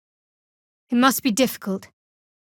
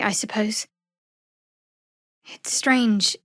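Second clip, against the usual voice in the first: ordinary talk, nothing marked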